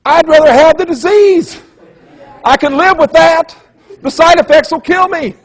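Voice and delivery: high, loud mock whine; exasperated viewer. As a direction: squeal it like a fed-up viewer watching the ad. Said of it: "you're sitting there going".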